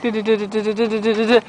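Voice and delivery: rapid-fire repeated du sound; playful stutter noise. Pretend. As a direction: rattle off a silly string of syllables. Makes playful noise at her dog.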